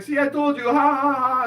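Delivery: high, loud mocking cackle; hammed-up "told you so" laughter; gloating laugh. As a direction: imitate a brother crowing in triumph. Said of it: "he's, like, laughing".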